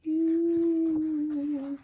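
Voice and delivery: You sung out long and high. Shown as a demonstration. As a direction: stretch the oo in You. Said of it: "The O sound is long and long".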